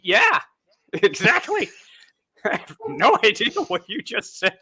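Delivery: high, slow, giggling fake agreement; laughing along. Host mocks himself nodding along to [name], laughing. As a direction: nod along while laughing at him. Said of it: "You're like".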